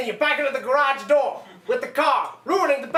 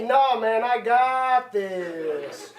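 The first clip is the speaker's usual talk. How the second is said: lower, drawn-out dopey Quinn voice; slow mock Quinn; 4 semitones lower, much slower than usual. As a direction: drag it out like a cocky dimwit. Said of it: "You say".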